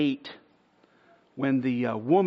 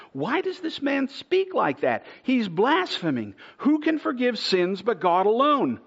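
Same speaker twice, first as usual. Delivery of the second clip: indignant scribes; 9 semitones higher, 4 dB louder than usual. Acted. Scribes questioning Jesus, acted.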